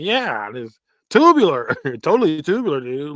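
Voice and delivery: hammed-up surfer guy; eighties surfer dude. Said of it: "the eighties surfer guy".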